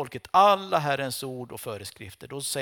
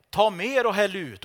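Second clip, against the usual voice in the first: raised, higher call to pour more; loud command; 5 semitones higher, 5 dB louder than usual. Acted. Elijah ordering more water poured, raised command. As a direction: bark the order to drench the altar.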